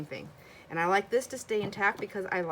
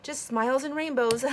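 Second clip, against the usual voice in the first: playful quip; 8 semitones higher, 6 dB louder than usual. Retold or imitated. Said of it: "And I said".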